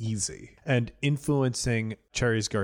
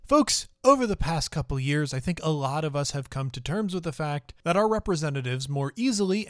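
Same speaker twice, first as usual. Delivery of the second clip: bright, raised announcer voice; podcast host outro; 5 semitones higher than usual. Acted. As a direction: sign off the show like a host.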